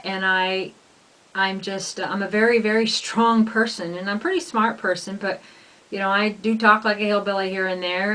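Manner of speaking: normal talking voice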